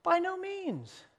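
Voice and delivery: high, slow insistence on by no means; a scandalized apostle's emphatic denial. Retold or imitated. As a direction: drag out by no means in high protest. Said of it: "that's what Paul says".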